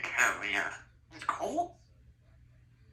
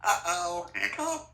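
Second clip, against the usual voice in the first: put-on talking to a baby. High squeak on hiccup.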